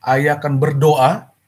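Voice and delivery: a furious father holding himself back; father sternly tells his son to wait for his punishment. Acted. Angry father orders son.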